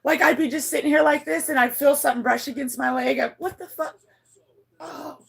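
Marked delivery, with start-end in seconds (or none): 3.4-5.2 high, soft startled gasp of words; hushed startle. Retold or imitated. Owner feels something brush her leg, hushed startled reaction. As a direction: say it small and spooked. Retold startle at a ghostly touch.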